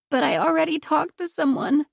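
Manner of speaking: pleading objection from a hospital bed; protesting. Acted. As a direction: push back, hurt and insistent. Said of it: "Josie protested".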